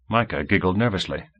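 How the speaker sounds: plain reading voice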